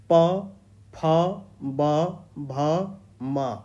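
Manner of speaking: sounding out प फ ब भ म for the class; reciting the lip consonants. Shown as a demonstration. Teacher sounds out the labial letters for students, clear and deliberate. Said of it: "तो देखिए प".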